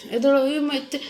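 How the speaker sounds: mother softly coaxing her son to get up. Real, not acted. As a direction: gently urge the little one up.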